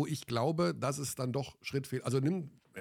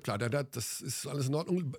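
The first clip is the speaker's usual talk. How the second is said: usual speaking voice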